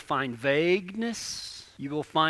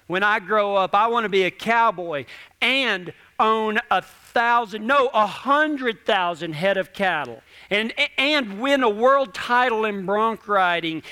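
put-on child's dream list; 5 semitones higher, 6 dB louder than usual. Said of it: "talk to a little kid about dreams".

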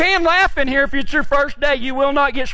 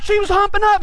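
high, quick protest; 8 semitones higher than usual. Retold or imitated. Quick protest in retold argument.

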